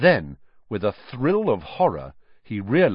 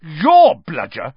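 outraged; 6 semitones higher, 10 dB louder, slower than usual. Acted. Harry's outraged interruption.

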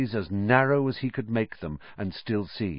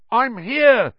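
muffled; 8 dB louder than usual. A narrator's reading. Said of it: "came Ron's muffled voice".

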